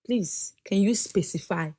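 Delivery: soft, slow, measured imitation of a husband; quietly retold polite request; husband's request. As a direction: ask slowly and softly, with patience. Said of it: "he will ask me one question".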